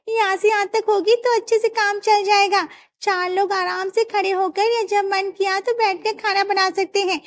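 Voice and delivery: high, sweet daughter-in-law asking a favour; young daughter-in-law. Acted. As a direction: coax the father-in-law for a bigger kitchen. Daughters-in-law measure kitchen.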